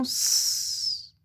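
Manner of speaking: long sustained hiss for "S"; pure held sss on "S"; isolated S sound. Shown as a demonstration. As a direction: say "S" as a drawn-out sss, not the letter name. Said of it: "de faire le son S".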